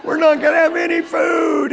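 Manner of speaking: high, loud panicked wail; mock child crying in panic. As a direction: shout it like a frightened little girl. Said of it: "she started crying out".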